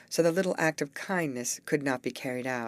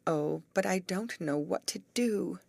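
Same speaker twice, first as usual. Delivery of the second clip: ordinary delivery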